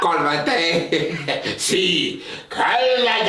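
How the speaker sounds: put-on slow, laughing Puerto Rican Spanish accent; hammed-up Puerto Rican accent, half laughing; mock Puerto Rican accent. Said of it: "I don't know how they say it".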